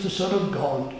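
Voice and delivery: ordinary talk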